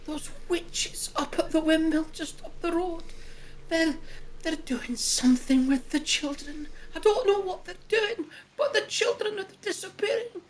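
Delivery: put-on light Scottish accent, a worried village mother; worried mum in a mild Scottish accent. Villager woman telling travellers in a lightly Scottish accent. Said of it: "I'm still Scottish".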